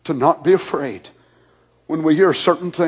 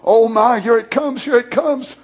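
mock panic; 6 semitones higher, 4 dB louder than usual. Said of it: "we'd say".

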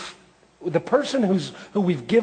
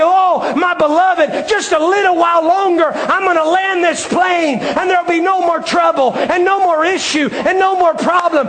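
impassioned promise; 11 semitones higher, 6 dB louder than usual. Acted. Pilot comforting the beloved.

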